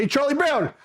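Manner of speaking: high-pitched mock call; retold hail to a recruit; imitated calling out. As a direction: call the nickname high, without shouting. Others call him by nickname.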